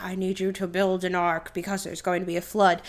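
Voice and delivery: low, soft booming God voice, understated; God's voice. Retold or imitated. God telling Steve Carell to build an ark, low and solemn. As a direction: play God commanding, deep and quiet. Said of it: "God is like".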